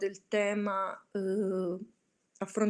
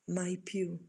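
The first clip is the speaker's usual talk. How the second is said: softer, hushed murmur from the raven; 2 semitones lower, 7 dB quieter than usual. Acted. The raven murmurs its reply to the pleading narrator. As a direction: breathe the answer like a dark omen. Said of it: "Mormorò l'augel".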